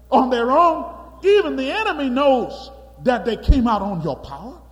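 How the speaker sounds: loud, high plea to the Lord; loud pleading. Acted. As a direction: beg the Lord loudly. Moses pleading with God.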